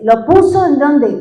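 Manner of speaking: normal talking voice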